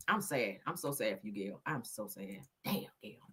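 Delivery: words breaking as grief takes over; choked-up crying. Real, not acted. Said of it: "I don't like to be crying on my show".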